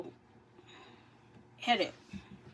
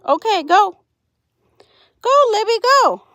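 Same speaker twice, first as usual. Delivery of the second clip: loud, high encouraging calls; excited cheering; 11 semitones higher, 10 dB louder than usual. Real, not acted. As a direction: cheer her on loudly. Cheering on a chicken.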